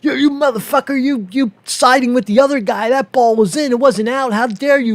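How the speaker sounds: screeching crybaby yelling at the ref; McEnroe ranting at the ref. Retold or imitated. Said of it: "He's a big baby".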